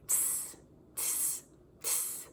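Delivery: whispered tss tss tss; monkey tsking. Acted. Monkeys answering the peddler, whispered tsking. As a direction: tut at him like a cheeky monkey. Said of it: "and said".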